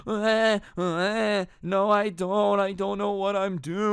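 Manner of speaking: high, wailing little-kid mock sob; hammed-up whiny crying, drawn-out words; fake crying. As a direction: imitate a small child bawling on cue. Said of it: "goes from smiling to just crying".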